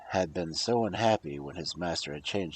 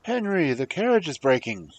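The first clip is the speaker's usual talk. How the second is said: higher, louder call to his servant; king's son calling out to Henry; crying out; 7 semitones higher, 6 dB louder than usual. Acted. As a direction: call back in alarm. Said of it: "He turned around and cried".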